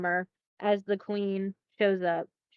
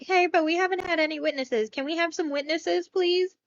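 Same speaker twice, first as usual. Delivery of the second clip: higher, pleading little king voice; higher timid king begging politely; 7 semitones higher than usual. Retold or imitated. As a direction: coax her like a timid husband. King asks for witnesses.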